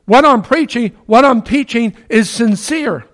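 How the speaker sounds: high, loud, pleading protest; Paul protesting his innocence before the council; impassioned plea. Retold or imitated. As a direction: cry out that you're sincere. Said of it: "Paul's saying".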